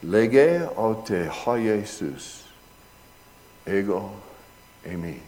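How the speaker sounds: Lege aute ha Jesus, ego eimi read out slowly, syllable by syllable; slow Greek reading. Shown as a demonstration. Preacher rereading the Greek text to the congregation, slow. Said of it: "So let me read it again".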